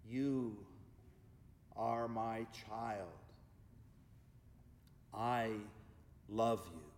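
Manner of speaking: very slow, low God voice. Acted. God speaks to someone in distress, low and very slow. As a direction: speak as God to the hurting, word by word. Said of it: "hear these words from God".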